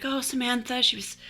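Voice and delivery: eulogize sweetly, lightly acted; gushing mourner. Mourner fondly eulogizing Samantha at the funeral, gushing.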